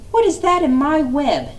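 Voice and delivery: high-pitched spider voice. Acted. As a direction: peer curiously at the web. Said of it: "asked a spider".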